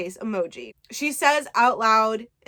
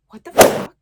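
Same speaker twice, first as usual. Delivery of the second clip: shocked outcry; 12 dB louder than usual. Retold or imitated. Shocked he's listening.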